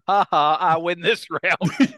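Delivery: laughing in triumph, slow; triumphant laugh. Real, not acted. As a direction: gloat through a laugh, slowly. Wins the dark joke.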